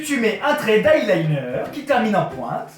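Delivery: ordinary talk, nothing marked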